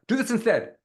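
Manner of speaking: high-pitched all through, no pause. Shown as a demonstration. Coach shows another wrong delivery, pitched high. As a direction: lift the whole phrase up high. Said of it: "Even if I said that high".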